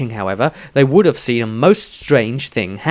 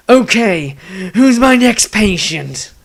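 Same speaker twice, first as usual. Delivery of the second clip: high, manic mock-doctor voice; a crazed healer relishing the fight, higher; gleeful madman; 6 semitones higher, 4 dB louder than usual. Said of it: "Dieter commented".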